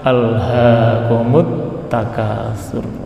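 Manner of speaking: slow, low, measured Quran recitation; Quranic recitation. Real, not acted. Preacher quoting the revealed verse, slow low recitation. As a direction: chant the verse slowly, softening at the end.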